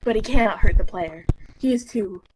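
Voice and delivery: normal talking voice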